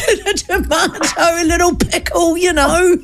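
high, giggling through a list; mimicking him through giggles; laughing. Retold owner listing fillings.

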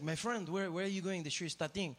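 imitated Frenchman questioning a friend; French-accented English, puzzled. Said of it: "the French guy turns to me".

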